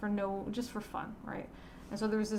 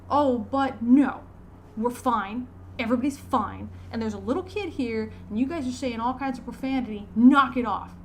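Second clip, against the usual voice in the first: loud scolding; 3 semitones higher, 7 dB louder than usual. Retold or imitated. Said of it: "I just kind of barked at him".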